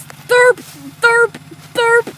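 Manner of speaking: imitating a bird call; bird call imitation. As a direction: chirp it like a bird. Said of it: "a noise that goes like this".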